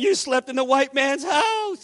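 high, drawn-out retold astonishment; amazed excitement. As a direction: imitate a man thrilled in disbelief. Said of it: "got him more excited than anything".

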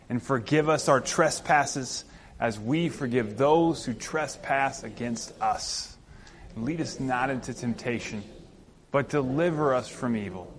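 slow, measured Lord's Prayer; congregational prayer. Real, not acted. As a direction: lead the church in prayer, phrase by phrase.

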